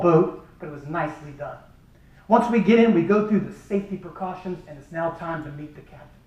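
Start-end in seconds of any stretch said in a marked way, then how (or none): none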